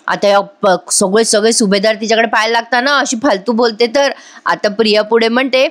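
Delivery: usual speaking voice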